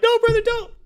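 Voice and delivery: very high, loud mock cry of alarm; panicked plea. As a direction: imitate a frantic yell to stop. Loki sees hammer flung at him.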